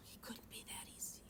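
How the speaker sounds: whispered student's doubt. Acted. Teacher voicing a student who thinks it seems too simple, doubting whisper. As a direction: whisper it like a secret second thought.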